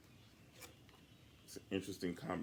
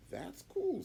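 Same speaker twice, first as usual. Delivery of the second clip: bright, much higher delight; genuinely impressed girl; a pleasantly surprised kid. Acted. Sophie delighted.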